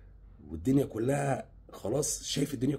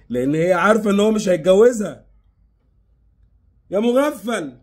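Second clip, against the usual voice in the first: much louder, higher furious outburst; angry scolding; 8 semitones higher, 12 dB louder than usual. Acted. Telling the naive man she won't marry the other, loud and angry. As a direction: shout it at him, fed up.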